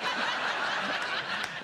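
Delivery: high breathy burst of laughter. Real, not acted. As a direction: cackle at his own joke. Host laughs at own joke.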